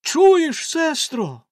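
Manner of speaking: a high, loud exclamation; joyful cry. A narrator's reading. The wife crying out to her sister, overjoyed. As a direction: burst out with hope. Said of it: "вигукнула вона".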